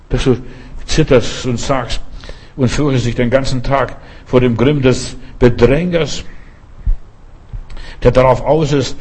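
usual speaking voice

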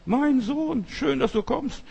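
delighted father greeting his returning son; loving father. Acted. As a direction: welcome the son home, voice lifted high. Father voiced in parable.

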